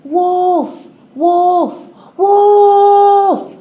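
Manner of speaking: high, very loud cry growing to a scream; frightened boy shouting for help from the tree; desperate shout. A narrator's reading. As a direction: scream for help as the real wolf attacks. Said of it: "Then he started shouting".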